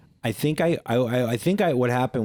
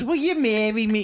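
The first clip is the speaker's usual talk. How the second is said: much higher, squeaky silly voice; goofy proposal voice; 10 semitones higher than usual. Retold or imitated. Mocking a guy who proposes in a silly voice. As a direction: squeak the question like a fool. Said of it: "If a guy goes".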